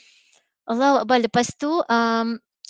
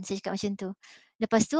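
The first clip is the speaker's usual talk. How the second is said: usual speaking voice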